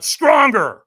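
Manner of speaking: loud, high push; demanding coach. Retold or imitated. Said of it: "And he went".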